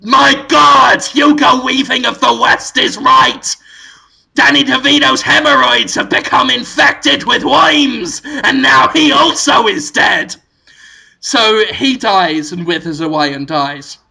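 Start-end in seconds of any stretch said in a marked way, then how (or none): 0.0-10.3 loud, high melodramatic revelation; hammed-up dramatic announcement; Willem Dafoe. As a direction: proclaim it in mock horror to the audience. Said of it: "Willem Dafoe says to the camera".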